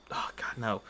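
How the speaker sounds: ordinary talk, nothing marked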